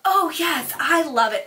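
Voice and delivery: high, over-eager oh yes; mock the saleswoman's gushing enthusiasm; hammed-up rave, starting high and easing down; gushing salesgirl. Mimics over-eager sales girl.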